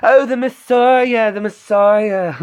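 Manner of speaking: high, slow falsetto with a laugh; Python falsetto. Retold or imitated. Redoing the Python voice for Brad, slow high falsetto. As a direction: hoot it out like the Python lady.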